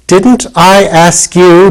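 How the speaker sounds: slow, high reproach, a put-on boyish voice; reproachful. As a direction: drag it out as a hurt complaint. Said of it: "in a reproachful voice".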